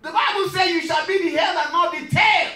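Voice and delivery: rising to a loud, high preaching shout; full preaching. Acted. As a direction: build the congregation up to a roar.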